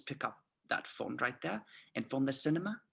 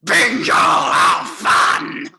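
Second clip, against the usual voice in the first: loud, high cry ending in laughter; gleeful shout; 8 semitones higher, 15 dB louder, much slower than usual. Acted. Colonel trying out the corrected American idiom, gleeful shout. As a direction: shout it with childlike delight.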